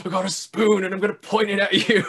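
hammed-up threat, high and loud; mock menacing. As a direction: imitate a menacing villain, half laughing. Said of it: "like, very menacingly".

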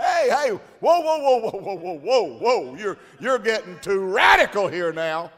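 high, loud repeated whoa, urgent; alarmed calling out. Retold or imitated. Preacher retelling how he ran over shouting at the man. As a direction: rush over calling him to stop.

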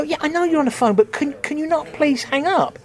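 high-pitched, restrained begging; imitated exasperated plea. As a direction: plead politely with someone, re-enacting it. Comedian pleads with phone user.

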